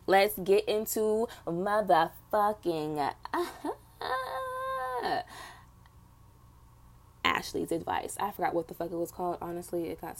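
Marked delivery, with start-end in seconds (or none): 4.0-5.5 sudden high squeal of a laugh; burst of laughter. Real, not acted. Host introducing the segment, breaking into high laughter. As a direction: crack up mid-sentence.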